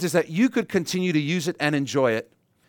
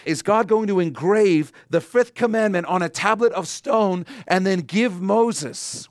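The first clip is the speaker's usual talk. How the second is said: usual speaking voice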